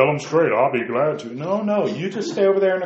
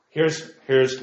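ordinary talk, nothing marked

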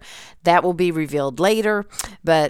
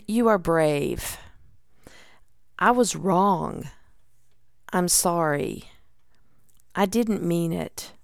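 slow, soft, sincere reassurance; tender affirmations; 4 dB quieter than usual. Acted. Giving examples of unspoken words we needed, tender and slow. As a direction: offer each short phrase gently, leaving space after it.

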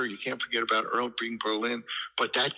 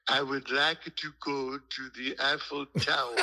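lightly put-on French accent, half laughing; a Brooklyn voice faking a French accent; mock French accent. Susan asking the Paris cab driver, in a mock French accent. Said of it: "speaking with a French accent".

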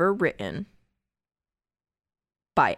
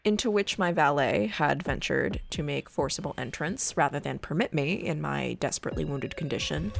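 hushed creepy legend telling; 2 semitones lower, 3 dB quieter than usual. Acted. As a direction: read it like a ghost story by candlelight. Reads Poe tale over music.

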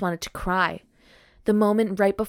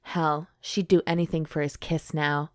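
usual speaking voice

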